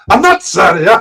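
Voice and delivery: high, loud gleeful reversal; Pee Wee Herman impression. Streamer quoting Pee Wee's twist, high and loud. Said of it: "my impression of Pee Wee Herman".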